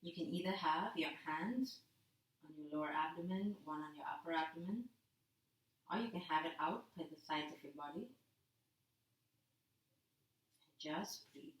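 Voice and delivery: a yoga teacher settling the class into rest; soft relaxation guidance. Acted. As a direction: ease them into rest gently. Soft relaxation instructions lying down.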